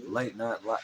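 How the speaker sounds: soft, low public-radio voice; soothing late-night radio delivery; NPR host. Acted. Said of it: "little N P R action".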